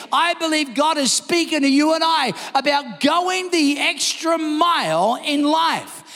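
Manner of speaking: usual speaking voice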